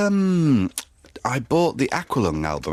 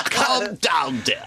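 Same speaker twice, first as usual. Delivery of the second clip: Michael Winner shouted; 7 semitones higher, much slower than usual. Retold or imitated. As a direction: bark it out like a patronising director. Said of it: "a Michael Winner impression".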